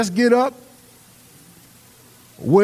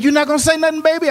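higher, teasing wife's question; 10 semitones higher, much faster than usual. Retold or imitated.

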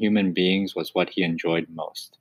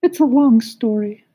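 sighing cricket; 6 dB louder than usual. Acted. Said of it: "sighed the cricket".

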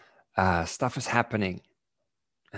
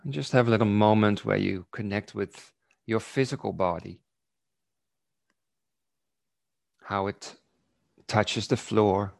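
guided meditation. Acted. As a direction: lull them gently into stillness. Leads a body awareness meditation.

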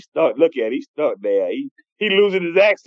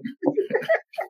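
laugh; 6 dB quieter than usual. Real, not acted. Laughs while teasing the coach.

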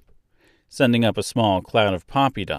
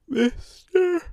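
high, stretched-out yawn; barely getting the word out through a yawn. A narrator's reading. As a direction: say it through a big sleepy yawn. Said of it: "she said with a big yawn".

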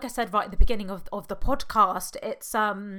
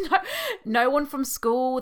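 laughing; 6 semitones higher, 6 dB louder than usual. Real, not acted. Laughing disbelief at being ignored.

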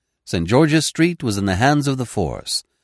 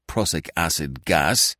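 slow, low-key bitterness; understated bitter reply; bitterly; 4 semitones lower, 3 dB quieter than usual. A narrator's reading. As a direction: spit out the cause, slowly and quietly. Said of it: "he said bitterly".

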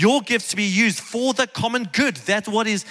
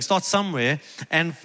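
ordinary talk

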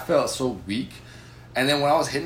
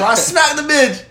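hyped boast; 12 semitones higher, 7 dB louder, slower than usual. Real, not acted. Boasting loud and slow.

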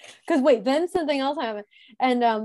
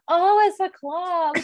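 higher, slow "Oh, it's a club" as the penny drops; sudden realization; 9 semitones higher, 5 dB louder, slower than usual. Retold or imitated. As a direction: stretch it out like it finally clicks. Sudden realization after confusion.

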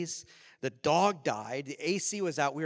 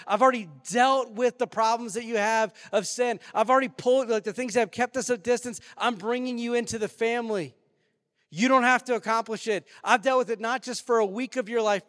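loud, raised, urgent proclamation; impassioned preaching; 6 semitones higher, 7 dB louder than usual. Acted. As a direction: proclaim the good news at full force. Preaches as God speaking.

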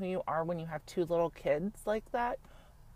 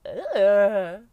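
disgusted ugh; 10 dB louder than usual. Retold or imitated. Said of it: "be like".

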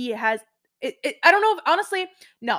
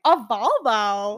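slow, louder drawn-out disbelief; incredulous repeat; 5 dB louder, much slower than usual. Retold or imitated. Repeating a friend's car choice back, incredulous. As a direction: stretch the car's name in mock disbelief. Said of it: "And I was like".

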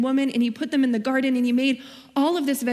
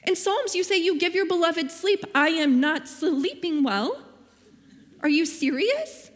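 much higher, slow exasperated whine; whiny complaint; 7 semitones higher than usual. Retold or imitated. Complaining to God about not sleeping, high whine. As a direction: plead like you're fed up and exhausted. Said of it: "I was like".

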